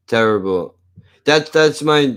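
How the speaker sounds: ordinary talk, nothing marked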